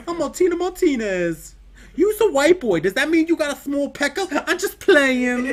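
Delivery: very high, loud sassy character voice; loud falsetto character impression; Martina Martinez. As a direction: squeal it high and brash, stretching the ends. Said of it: "doing legit Martina Martinez right now".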